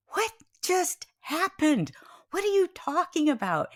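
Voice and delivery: high, stunned questioning; incredulous wife, hammed up; retold disbelief. Stunned wife reacting on stool.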